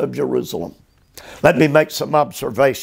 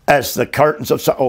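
fast, tossed-off echo of the verse; fast restatement of "As the curtains of Solomon"; quick repeat; much faster than usual. Shown as a demonstration. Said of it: "quick repeat of the verse".

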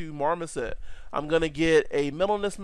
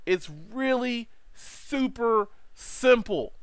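excited; 6 semitones higher, much slower than usual. Real, not acted. Enthusing about easy export.

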